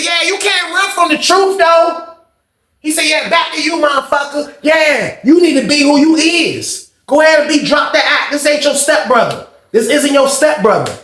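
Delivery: high taunting yell; retold taunting. The boy still taunting Sean as the door closes, high yell. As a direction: keep jeering as you're led off.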